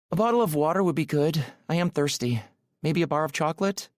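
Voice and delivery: high, silky woman's voice, quick in places; Aurora. A narrator's reading. Woman asking her driver for snacks, melodious and silky. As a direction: ask lightly in a high, melodious accented voice. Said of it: "her voice is rich and silky".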